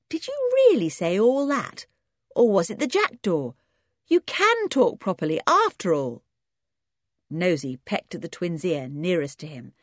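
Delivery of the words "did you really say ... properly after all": high, wide-eyed disbelief; amazed question. Acted. Dick amazed at the silent twins, high and surprised. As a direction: ask as if you can't believe it. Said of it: "said Dick, staring at them".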